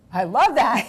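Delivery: loud, high imitated enthusiasm; retold delight. Star reacting to a silly character idea, mock-delighted. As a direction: imitate someone thrilled by an idea. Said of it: "and she was like".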